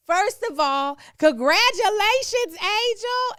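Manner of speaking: high, loud gushing congratulations; excited. Retold or imitated. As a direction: burst out with big cheerful praise. Friend's excited group-chat text.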